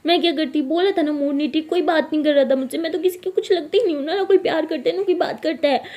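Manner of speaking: milk the self-pity, hammed-up; hammed-up sulky wail to mom; fake weepy drama. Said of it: "ये drama क्यों कर रही हो".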